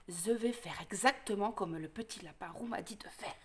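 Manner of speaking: hushed, lisping wolf; whispering to himself. Acted. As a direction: whisper a secret plan to yourself. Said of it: "Le loup se dit". (chuchotement du loup qui zozote)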